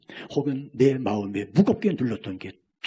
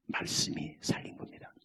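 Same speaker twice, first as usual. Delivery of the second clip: usual speaking voice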